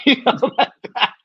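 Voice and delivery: high, laughing through the words; can't stop laughing at it. Real, not acted. Laughing after the ticket story.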